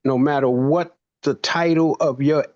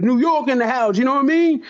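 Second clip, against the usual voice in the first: playful New York hype, the second York higher; joking; 9 semitones higher, faster than usual. Retold or imitated. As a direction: hype up your hometown for laughs. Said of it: "'Cause I like to make fun".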